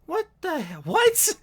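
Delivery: very high, baffled mock yell; squeaky confused outburst. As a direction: shriek it in disbelief. Voodoo guy confused.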